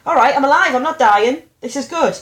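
rally yourself out loud; emphatic self-reassurance. Retold or imitated. Convincing oneself after a crash, loud and upbeat.

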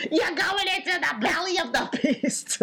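high, hammed-up pleading, cracking toward laughter; mock desperate plea, way up high; Diane Lane's frantic wife. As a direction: beg Billy to turn back, over the top. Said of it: "Don't do her voice again".

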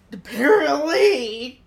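joking fake tears about fewer letters; mock hurt. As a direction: whine it like a wounded parent, half laughing. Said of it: "I'm kidding".

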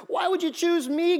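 high, slowed plea of a timid guy. Acted. Timid Timothy asks God why he was chosen, high timid plea. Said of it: "quiet, shy, timid guy".

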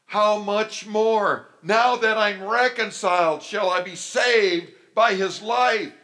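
loud, high, fervent proclamation; ringing, high-pitched revival preacher; full-force preaching. Real, not acted. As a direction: drive the promise home at a shout.

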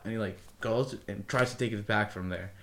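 usual speaking voice

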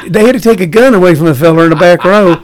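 mock Southern accent; Southern booker. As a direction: confide a tale like a Southern club booker. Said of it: "he said it in a Southern accent".

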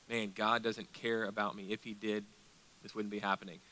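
quiet, low doubting thought; discouraged inner thought. Acted. Preacher voices a struggling believer's doubt about God, quietly discouraged.

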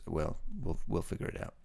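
ordinary delivery

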